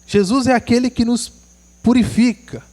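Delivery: ordinary talk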